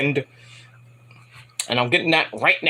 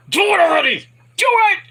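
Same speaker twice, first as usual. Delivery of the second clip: high, strained urging; hammed-up shouted goading, climbing higher; impatient mock yell; 14 semitones higher, 3 dB louder than usual. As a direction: imitate onlookers yelling an impatient order. Onlookers urging him on.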